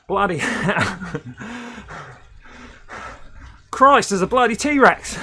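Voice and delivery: slow, high, hammed-up Aussie accent; Steve Irwin. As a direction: exclaim in a drawn-out mock Aussie accent. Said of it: "started off like a Steve Irwin TikTok".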